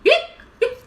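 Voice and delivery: high, loud pretend hiccups; mock hiccups. Elephant hiccups on after drinking water, mock hiccups. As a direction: burst out with sharp, squeaked hiccups. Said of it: "the hiccups just wouldn't go".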